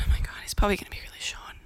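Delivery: rushed whisper of dread; fast panicked whisper. Retold or imitated. Whispering in panic as her date stands up. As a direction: hiss it quickly under your breath.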